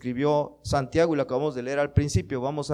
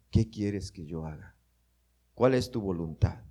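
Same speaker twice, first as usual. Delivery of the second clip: humble prayer; 8 semitones lower, 6 dB quieter than usual. Acted. Godly person asks God humbly.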